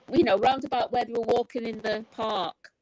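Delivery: ordinary delivery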